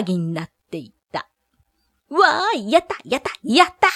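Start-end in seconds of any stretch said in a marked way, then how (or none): 2.1-3.9 high, gleeful cheer; excited cheering. Acted. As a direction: shout it for joy. Townsfolk cheering rats gone.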